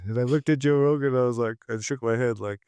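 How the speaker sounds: usual speaking voice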